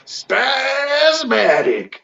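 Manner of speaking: high, long drawn-out product name; chipper 1950s ad. Acted. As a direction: sing out the brand like an old jingle. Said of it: "it says".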